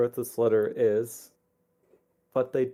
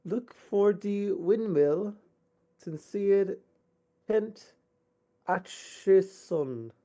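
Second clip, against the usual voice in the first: slow, slightly raised letter voice; lightly voiced letter writer; 8 semitones higher, much slower than usual. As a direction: read it like a pleading parent. Reads letter in character voice.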